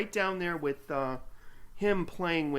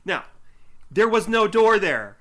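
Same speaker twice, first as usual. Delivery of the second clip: much louder and higher, exasperated; emphatic outburst; 8 semitones higher, 13 dB louder than usual. Real, not acted. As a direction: hit it like the punchline of a complaint. Indignant complaint about the scene.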